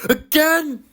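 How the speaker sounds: very high shocked again; aghast. Acted. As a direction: disbelief at losing twice. Sir Handel aghast.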